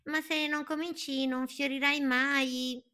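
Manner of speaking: very high, light bird voice; high little bird asking. Acted. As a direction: chirp it like a tiny curious bird. Bird encourages tree.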